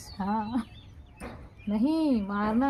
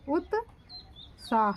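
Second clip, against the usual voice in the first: slow dictation; much slower than usual. Shown as a demonstration. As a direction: drag out उत्साह for dictation.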